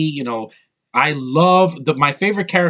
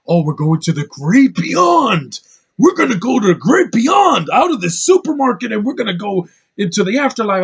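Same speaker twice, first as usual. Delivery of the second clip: loud, much higher, hammed-up rapture; over-the-top proclamation; 7 semitones higher, 6 dB louder than usual. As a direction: shout it like a preacher of the great beyond. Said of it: "that could have been toned down".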